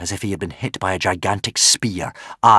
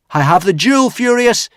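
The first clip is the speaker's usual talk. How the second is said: higher, louder defiant shout; 13 semitones higher, 8 dB louder than usual. Acted. Hiccup shouting up to the dragon Furious. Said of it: "Hiccup shouted".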